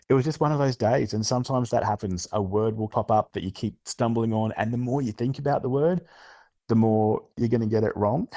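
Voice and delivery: ordinary talk, nothing marked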